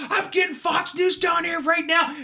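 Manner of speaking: high, shouted threat; mock angry shout. Voter threatens poll workers, high mock shout. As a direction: yell it like a furious voter. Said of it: "he's like".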